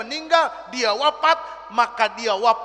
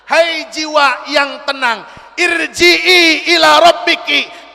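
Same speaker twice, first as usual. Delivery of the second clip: slow, loud and higher; preaching at full force; 4 semitones higher, 8 dB louder than usual. Real, not acted. Preacher shouting the verse's meaning to the hall. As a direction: call each phrase out to the hall.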